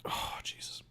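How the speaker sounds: breathy whisper. Real, not acted. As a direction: whisper it under your breath. Whispered aside.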